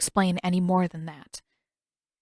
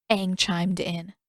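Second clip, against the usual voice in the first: plain reading voice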